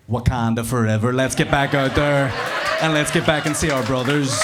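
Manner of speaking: loud, rousing chant; a pumped, heroic rallying call; rallying cry. Retold or imitated. Comic inner hero rallying to Uganda, loud and rousing. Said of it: "was like".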